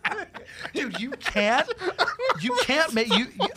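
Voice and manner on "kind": put-on laughing, high Scottish-accented quote; broad Scottish accent. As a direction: giggle through a Scottish accent. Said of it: "with a heavy Scottish accent".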